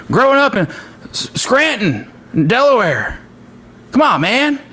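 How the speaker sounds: high, swooping presidential impression; Joe Biden. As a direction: stretch the place names like a folksy politician. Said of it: "Every time I watch a Biden speech".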